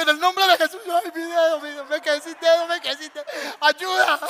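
high, loud wail about his finger; mock panic, crying for help; hammed-up pained wailing. Pastor gritando por su dedo. (lamento exagerado, agudo y fuerte, pidiendo ayuda)

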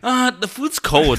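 high, drawn-out mock whine; mock complaint. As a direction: imitate a complainer, dragging the words out. Mimicking coworker's usual food complaint.

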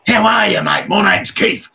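rough jailbird voice, bursts into a shout; boob head talk. Retold or imitated. Describing jail inmates to the host, imitating a rowdy ex-con. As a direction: impersonate a swaggering ex-con just out of prison. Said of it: "They talk like this".

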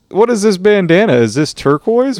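mock indignant gangster. Gang member scolding about bandana.

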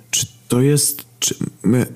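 high, halting, broken-off question; dumbfounded stammer. Acted. Stunned brothers in a sudden new place, faltering. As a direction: falter between words, unable to finish. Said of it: "nie mogliśmy wykrztusić z siebie nic więcej".

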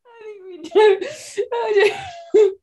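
high-pitched, squeaky laughing words. Real, not acted. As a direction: crack up while speaking. Giggling fit over podcast equipment.

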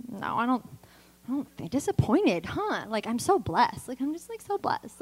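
small, soft, halting voice with pitch jumps; mock flustered denial. Woman denying disappointment to her counselor, mock flustered and soft. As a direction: imitate someone faltering while insisting all is fine.

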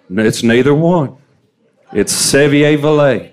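Sevier-Villay said slowly as seh-vee-ay-vil-lay, French style; slow straight-faced Sevier-Villay with a French twist; deadpan fake French. Local deadpanning a fake French name to a tourist. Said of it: "I say with a clear face".

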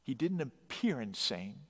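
ordinary delivery

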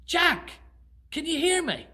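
high, loud calling voice; voice from above. Acted. God calls down to Jack, booming. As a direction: call down from the sky. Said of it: "a voice came back".

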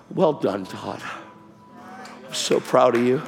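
a hint of a proud heavenly father, low and gentle; imagining God welcoming him home, low and soft; God's voice. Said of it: "He's gonna go".

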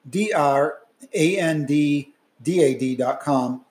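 brisk, clear announcer read of the disclaimer; podcast outro read. Acted. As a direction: read it like a formal podcast sign-off.

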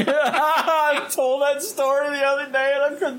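high, laughing through the words; real giggling telling. Real, not acted. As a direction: talk while cracking up. Laughing while retelling.

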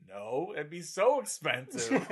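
a mocking take on his younger self turning down the better console; naive younger self. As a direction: play his clueless twelve-year-old self.

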